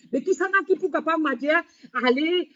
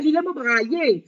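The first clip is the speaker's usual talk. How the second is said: normal talking voice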